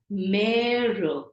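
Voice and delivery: "mère" with a long, drawn-out open eh; stressed A sound. Shown as a demonstration. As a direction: stretch the vowel of "mère": "mehhr". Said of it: "really stress on the A sound".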